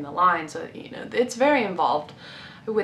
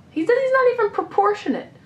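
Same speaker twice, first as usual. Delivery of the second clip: much higher, louder complaint; indignant outburst; 12 semitones higher, 5 dB louder, faster than usual. Real, not acted. Complaining about the cover figure, high and indignant. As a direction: squeal it in indignation.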